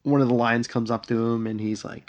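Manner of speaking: ordinary talk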